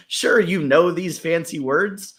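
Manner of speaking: normal talking voice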